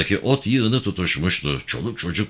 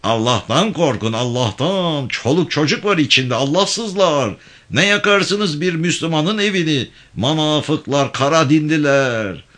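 outraged shouting; 9 semitones higher than usual. Acted. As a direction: rail at the arsonists with growing fury. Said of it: "Herkesten çok o bağırmaya başladı". (öfkeyle yükselen, yüksek sesli bağırış)

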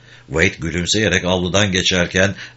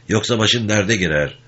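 ordinary delivery

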